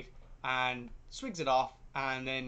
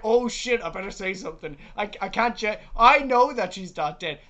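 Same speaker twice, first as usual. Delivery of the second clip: raised, louder voice of acted realisation; mock alarm; 4 semitones higher, 6 dB louder than usual. Priest at the burial realising his mistake, mock alarm. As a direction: play a man suddenly alarmed, hammed-up.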